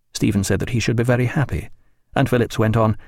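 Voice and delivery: ordinary delivery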